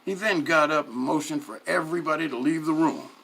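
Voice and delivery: plain reading voice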